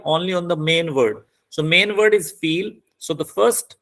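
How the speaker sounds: normal talking voice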